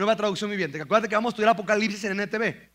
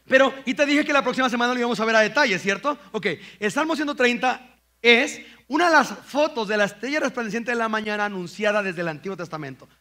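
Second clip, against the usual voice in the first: ordinary talk, nothing marked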